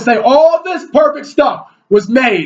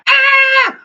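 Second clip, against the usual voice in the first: mock frightened yelp. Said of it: "he's like".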